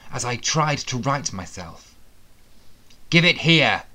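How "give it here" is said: loud, high demanding snap. Acted. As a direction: bark the order at him. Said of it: "Sebastian demanded".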